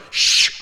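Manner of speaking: sharp "shh" to silence someone; hushing. Acted. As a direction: shush an interrupting voice. Acts out hushing the Spirit.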